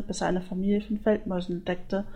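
ordinary delivery, nothing marked

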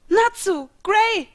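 high, loud cry of two names; startled high-pitched shout. A narrator's reading. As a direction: scream out to friends. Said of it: "schrie ich auf".

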